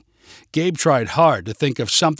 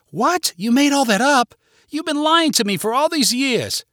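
very high, indignant disbelief; outraged; 11 semitones higher, 3 dB louder than usual. Acted. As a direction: burst out at the liar, pitched way up. Wife learns of husband's lie.

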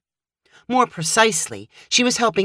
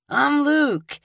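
eager grin; 10 semitones higher than usual. Acted. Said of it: "he added with an eager grin".

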